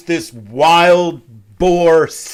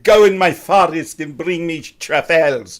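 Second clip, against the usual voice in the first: a terrible put-on French accent; hammy French accent, barely there; bad French accent. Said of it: "the worst French accent I could possibly do".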